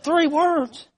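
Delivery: very high, questioning echo; puzzled echo question. Retold or imitated. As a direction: repeat it back as if taken aback. Preacher echoes her, puzzled.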